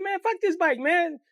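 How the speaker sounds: put-on young boy asking his friend why he stopped; worried kid. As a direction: ask like a scared little kid. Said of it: "I said".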